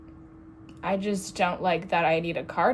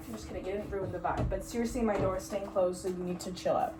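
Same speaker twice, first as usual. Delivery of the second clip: quiet teasing; 10 dB quieter, faster than usual. Real, not acted. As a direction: drop the volume as if talking off to the side.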